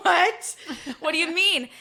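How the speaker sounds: laughing, high, loud disbelief; stunned and laughing at the offer; laughing high what; shocked what. Retold or imitated. Shocked at being asked.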